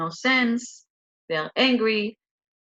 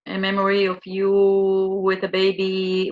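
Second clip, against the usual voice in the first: ordinary talk, nothing marked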